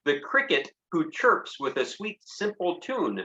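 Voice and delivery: lively high sing-song reading; poem reading. A narrator's reading. Teacher reads the cowboy poem to children, high and lively. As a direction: paint the wolf for the kids.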